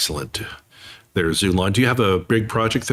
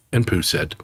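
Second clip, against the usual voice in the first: ordinary delivery, nothing marked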